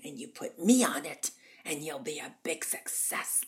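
husky diva, key words punched; Norma Desmond. Retold or imitated. Norma demands the magazine cover, husky imperious imitation. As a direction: imitate a grand old star demanding the spotlight. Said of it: "She goes".

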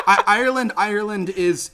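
a burst of loud, keen enthusiasm; excited. Real, not acted. Excited reply after laughing.

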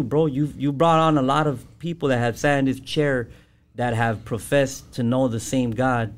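usual speaking voice

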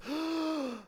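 high sudden intake of breath; sharp revival gasp. Acted. Dead son sitting up on the bier, sudden gasp. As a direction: gasp back to life.

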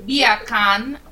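loud, drawn-out Jamaican "beer can"; put-on Jamaican lilt; Jamaican accent. Shown as a demonstration. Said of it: "in Jamaican".

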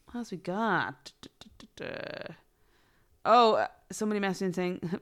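usual speaking voice

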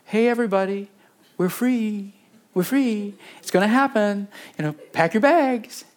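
playing the passive celebrant; a touch of breezy excitement; mock cheerful announcement. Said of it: "He doesn't go".

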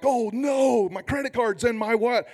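high, hammed-up dismay; mock panic. Preacher acting out losing a wallet, mock panic. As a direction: play at panicking over a loss.

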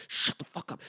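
imitated furious hissed whisper; angry whisper. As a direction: whisper it harshly, as the fed-up stepdad. Stepdad hushing laughing teens at dinner.

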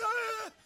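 high squeaky wail; hammed-up exclamation; silly mock cry. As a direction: yelp like a flailing scoffer. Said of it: "you gotta wave your head".

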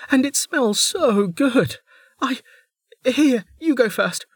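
very high bubbly voice; a cheerful girl babbling apologies; chirpy young woman. A narrator's reading. Niriri apologising to Velika, bubbly and high.

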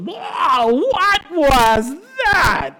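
high, loud, drawn-out astonished cry; Rafiki. Acted. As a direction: stretch each word in loud, high amazement. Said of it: "If you imagine just Rafiki".

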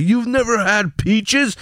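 high, slow amazed disbelief; incredulous wife. Retold or imitated. Wife can't believe he's never had peaches, slow and high. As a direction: stretch it out like you can't believe it.

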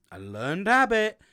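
a slow loud payoff to the question; emphatic punchline. Real, not acted. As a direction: hit it home as the big reveal. Punchline answer to his question.

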